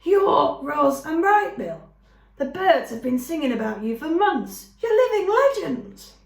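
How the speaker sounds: squeaking with excitement. A narrator's reading. Skunk meeting famous visitors, squeaking with excitement. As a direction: squeal like you just met your heroes. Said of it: "the voice squeaked with excitement".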